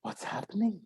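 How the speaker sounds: soft whisper in wonder; awed whisper. Acted. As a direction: breathe it out in awe. Villagers whisper in awe.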